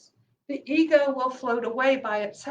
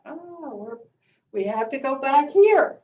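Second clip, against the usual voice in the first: usual speaking voice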